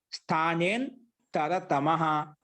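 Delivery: very slow, syllable-by-syllable recitation; drawn-out sutra recitation. Shown as a demonstration. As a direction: stretch the sutra out for students to follow. Repeats the sutra for students.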